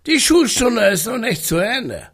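growled warning. Acted. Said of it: "die sturmige Stimme".

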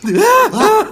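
high, loud shrieking gasps of fright; panicked high scream; terrified shriek. Acted. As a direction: shriek in sudden panic at the shape above. Sees creature on ceiling.

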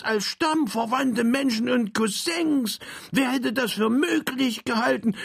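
high, elated cousin; joyful reunion. Acted. Frank greets his found cousin, high joyful. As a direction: call him in with joy, high.

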